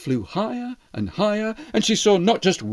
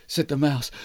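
plain reading voice

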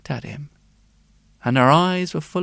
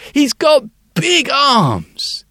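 put-on high, slow child's voice; proud little girl boasting; bright little girl; 11 semitones higher, 6 dB louder, slower than usual. The girl describes her daddy to the sculptor, high and slow.

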